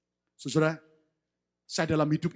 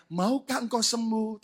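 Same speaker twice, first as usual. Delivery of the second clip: slow gentle question; 4 semitones higher than usual. Acted. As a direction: ask it slowly and gently. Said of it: "Saya yakin ngomongnya enggak begini".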